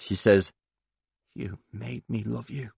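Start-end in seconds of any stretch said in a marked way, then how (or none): 1.3-2.7 hushed, husky melodrama; low, husky voice. Acted. Paul's parting words to Claude, low and husky. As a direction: drop to a soft husky reproach. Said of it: "In a low, husky voice".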